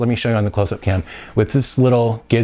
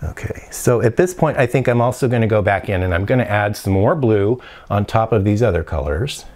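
ordinary talk, nothing marked

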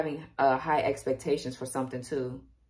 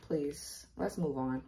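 much softer, pleading under the breath; fighting tears; 9 dB quieter, slower than usual. Real, not acted. Reader asks herself to move on before crying on camera, soft pleading voice. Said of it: "don't get me crying on here".

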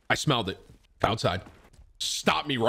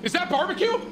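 very high, mock-astonished question; acted sudden distraction. Streamer re-enacting smelling barbecue mid-conversation, acted astonishment. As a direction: re-enact catching a whiff, pitched way up. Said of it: "and I go like this".

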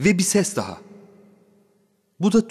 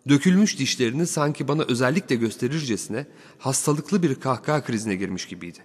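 plain reading voice

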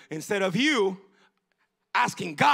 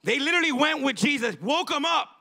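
preaching; 6 semitones higher, 4 dB louder than usual. Acted. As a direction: preach it out with rising force. Preaching the storm story.

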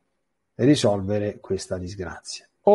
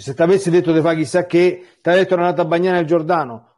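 higher and louder scolding retort; mock rebuke; 6 semitones higher, 6 dB louder than usual. Servants chiding their master, a raised mock rebuke. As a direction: scold him as the servants did.

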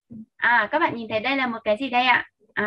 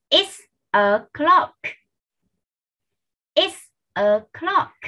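very slow, slightly raised pitch, word by word; careful higher-pitched teacher demonstration; slow model sentence; 5 semitones higher, 3 dB louder, much slower than usual. Shown as a demonstration. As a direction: space out each word for learners to copy.

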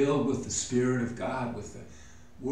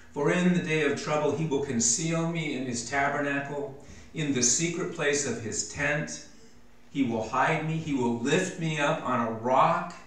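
raised, fervent scripture read; 4 semitones higher, 5 dB louder than usual. Acted. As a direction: proclaim the psalm with fervor. Reads psalm with rising fervor.

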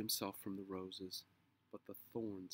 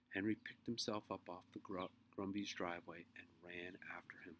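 ordinary delivery

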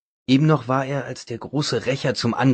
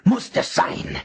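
frantic certainty; 12 semitones higher, much slower than usual. A narrator's reading. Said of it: "schrie er wieder".

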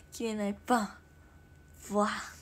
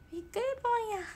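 much higher put-on cute voice; cute catchphrase; 12 semitones higher, 4 dB louder, faster than usual. Streamer answering a viewer with a cute catchphrase. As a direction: squeak it playfully.

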